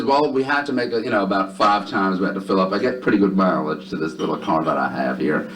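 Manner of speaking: easy Southern twang, pitch dropping as it goes; exaggerated Southern accent; thick Southern drawl. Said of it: "in a thick, heavy Southern drawl".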